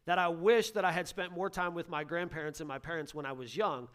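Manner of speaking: ordinary talk, nothing marked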